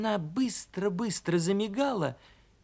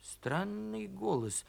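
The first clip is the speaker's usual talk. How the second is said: very soft inward musing; thinking to himself; 4 semitones lower, 9 dB quieter than usual. Acted. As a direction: wonder softly to yourself. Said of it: "подумал он".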